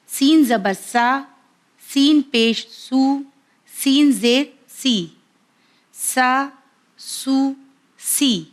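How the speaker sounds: سین زیر سی said slow and clear, vowel drawn out; teacher's letter drill. Shown as a demonstration. Teacher drills viewers on سین with its three vowel marks, slow and clear. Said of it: "اسے اس طرح ادا کرنا ہے".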